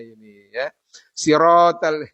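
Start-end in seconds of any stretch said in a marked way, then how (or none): 1.1-2.0 "Sirotal" loud, with a rounded O; children's misreading. Retold or imitated. Teacher mimicking young pupils' mistake, loud rounded "Sirotal". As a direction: imitate kids reading "Sirotal" with O for A. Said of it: "Kadang kita dengar anak-anak kita".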